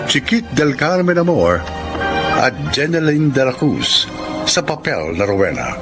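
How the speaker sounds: ordinary talk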